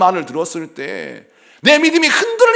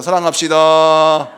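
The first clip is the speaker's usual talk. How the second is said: drawn-out exhortation; 3 semitones lower, 7 dB louder, slower than usual. Real, not acted. Exhorting congregation.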